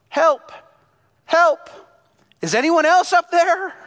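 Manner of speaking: high, loud shout upward; yelling for help. Acted. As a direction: yell desperately for anyone else. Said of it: "And then Jack yelled".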